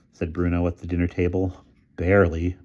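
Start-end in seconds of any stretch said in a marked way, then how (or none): none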